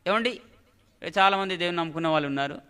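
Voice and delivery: normal talking voice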